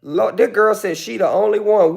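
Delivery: usual speaking voice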